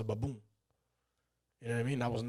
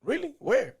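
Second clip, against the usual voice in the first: much higher, excited disbelief; surprised; 13 semitones higher, 7 dB louder, slower than usual. Retold or imitated. Retelling his amazed reaction to hearing about capoeira. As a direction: jump up in pitch, amazed. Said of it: "I'm like".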